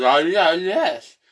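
"Oh, yeah, yes" as loud, slurred vowels; mouth-guard garble. Retold or imitated. Patient protesting to the dentist through a guard, garbled. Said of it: "but all he heard was".

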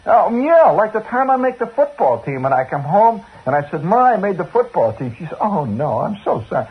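high, loud exasperated rant; indignant outburst. Retold or imitated. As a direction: pitch it up in mock outrage. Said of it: "mocking indignant retelling".

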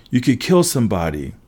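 plain reading voice

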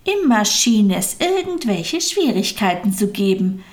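ordinary delivery, nothing marked

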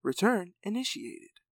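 lightly voiced robot announcement. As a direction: say it like a car computer. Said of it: "a robotic voice said".

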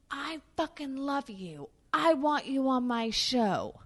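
high, slow, emphatic mock Roseanne; imitating an effusive boss; gushing star voice. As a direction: declare it with big affection.

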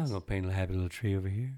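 soft, fast painter voice; lightly voiced gentle painter; Bob Ross impression. Friend jokes about Bob Ross calling, soft and quick. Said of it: "Bob Ross calling".

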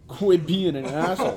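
laughing out the punchline loud. Real, not acted. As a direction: burst out laughing mid-line. Laughing while giving blunt advice.

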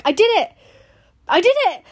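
high, loud cry of triumph; excited shout. Retold or imitated. Runner reliving the stadium finish, shouting with joy. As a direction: shout it out like crossing the finish line. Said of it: "I was so excited".

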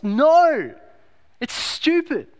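high, emphatic dismissal; exasperated answer to an absurd question. Acted. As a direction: draw out a loud no, then scoff high.

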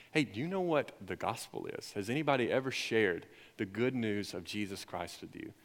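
softly spoken, low-key invitation; retold question in a hushed, easy voice; gentle asking. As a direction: ask softly, as if approaching strangers. Gently approaching students with a question.

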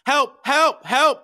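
high, loud cries for help; re-enacted desperate yell. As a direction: call out urgently, high and loud. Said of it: "yelling help".

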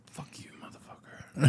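hissed under the breath, voiceless; whispered curse. Retold or imitated. To co-host, recalling a grudge against the pawn shop, whispered curse.

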